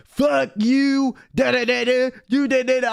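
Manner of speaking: high mock yell trailing into da-da filler; retold screaming. Passenger screaming at a house from the car, mock yell. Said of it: "screaming out the window".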